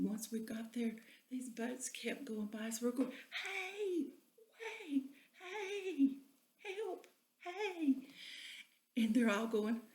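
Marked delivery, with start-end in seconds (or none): 3.4-8.1 high calls for help; calling for help. Retold or imitated. Stranded couple calling to passing boats, high and urgent.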